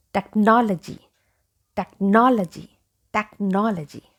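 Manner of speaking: "technology" with NOL punched, tek-NOL-uh-jee; stress on NOL. Shown as a demonstration. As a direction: hit the second syllable of "technology". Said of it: "stress is given on".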